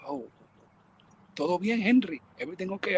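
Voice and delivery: imitated soft-spoken mother; softly voiced Spanish-speaking mom; quiet woman. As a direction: gently check in, voice kept small. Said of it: "Mother's kinda real quiet woman".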